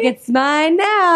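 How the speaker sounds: high, drawn-out villain taunt; hammed-up gloating killer; over-the-top sadistic. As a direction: stretch it out in mock-sadistic triumph. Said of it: "Just the most over-the-top sadistic".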